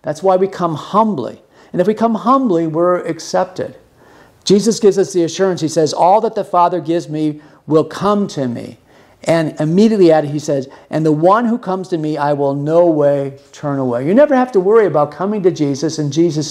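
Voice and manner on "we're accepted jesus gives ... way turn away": ordinary talk, nothing marked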